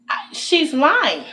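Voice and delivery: drawn-out, slow "she's lying"; slow, deliberate accusation. Real, not acted. Venting to viewers, slowly stating the supervisor is lying. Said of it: "Now I'm self telling them".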